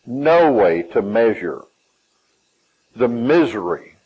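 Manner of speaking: ordinary delivery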